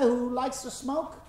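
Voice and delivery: ordinary talk, nothing marked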